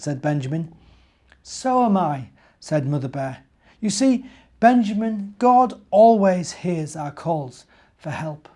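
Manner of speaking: put-on animated storyteller reading to kids; story narration. A narrator's reading. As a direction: read it like a bedtime story to little ones. Reads the Advent story aloud.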